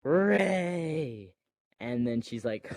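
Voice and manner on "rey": high, drawn-out name call; mock iconic call. Finn calling to Rey as he comes out, mock dramatic call. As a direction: call it out long, imitating a film hero. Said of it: "he says his iconic line".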